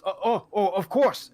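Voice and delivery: high, jittery, eager of course; flustered half orc. Acted. Half orc cook hurrying to serve, high and flustered. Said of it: "And he goes".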